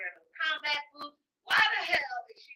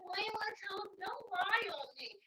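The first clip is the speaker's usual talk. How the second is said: mock pleading protest; 7 semitones higher, 4 dB quieter than usual. Woman begging the man not to lie, mock pleading protest. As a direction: plead high and stretch the last words.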